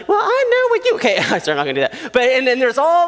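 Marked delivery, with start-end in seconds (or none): 0.1-1.0 squeaky high mock whine of a sister. Sister snapping back at her brother, squeaky whine. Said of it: "she's over here like".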